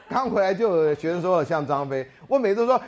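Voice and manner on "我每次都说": ordinary talk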